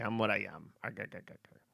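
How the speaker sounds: sailor catchphrase with a muttered chuckle; playful cartoon sailor impression; Popeye. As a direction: lightly imitate the cartoon sailor, trailing into his laugh. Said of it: "What are you, Popeye?".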